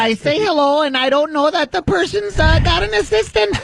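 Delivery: high mocking imitation, far above own voice; Sarah Palin impression. Radio host parroting Palin on the prank call, mocking impression. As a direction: mimic her in a high, put-on voice.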